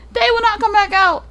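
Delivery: high, loud legend climax; ringing, high-pitched warning; dramatic warning. Real, not acted. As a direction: proclaim it high and loud, as a doom.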